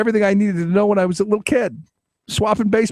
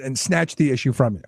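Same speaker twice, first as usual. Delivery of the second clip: ordinary talk, nothing marked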